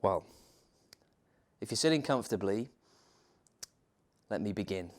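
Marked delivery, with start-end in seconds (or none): none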